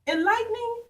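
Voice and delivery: punchy, rhythmic picture-book narration with big pitch swings; animated story read-aloud. A narrator's reading. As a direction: read it to children like a ringside showdown. Reads own picture book to children.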